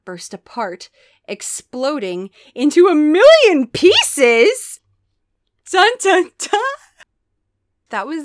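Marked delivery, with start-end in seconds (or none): 1.3-4.8 slow, loud, building to a high shout; hammed-up cliffhanger, belted out; dramatic climax. A narrator's reading. 5.7-6.6 loud, high mock suspense sting; sung-out "dun dun dun" cliffhanger; dramatic sting. Voices suspense sting after cliffhanger.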